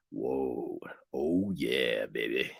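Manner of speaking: Brendan's low quiet voice. Retold or imitated. Host mocking Brendan charming fans, deep hushed croon. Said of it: "a little bit low and quiet".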